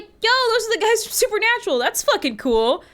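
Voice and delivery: mock-excited fan voice; excited spotting. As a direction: point them out like a delighted fan. Imagined spotting TV stars.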